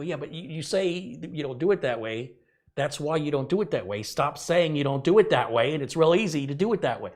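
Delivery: mock-heated lecture to bosses; retold heated rant. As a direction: hammer the point home.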